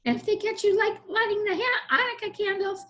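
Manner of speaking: high squeaky goblin threat; goblin warning. Acted. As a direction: warn him off like a sly little goblin. Goblin warns of bigger goblins.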